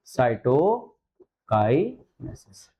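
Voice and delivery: Cytokinesis drawn out with a rising end; very slow dictation. Shown as a demonstration. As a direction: stretch cytokinesis out, voice going up. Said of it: "क्या नाम देंगे बेटा". (बहुत धीरे, अंत में ऊँचा सुर)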